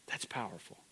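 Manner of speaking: whispered. Acted. Preacher whispers after Joseph's words.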